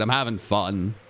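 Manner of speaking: usual speaking voice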